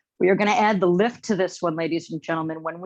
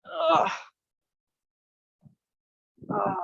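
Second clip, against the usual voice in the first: pained grunt; 10 semitones higher than usual. Real, not acted. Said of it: "Shin is cramping".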